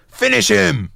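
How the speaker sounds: high, loud mock game announcer yell. Host teasing the guest with a mock loud yell. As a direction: bark it like a fighting game finisher. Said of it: "you ever just yell out".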